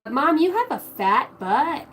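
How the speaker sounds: daughter's voice. Retold or imitated. Mother imitating her little daughter, slow and high-pitched.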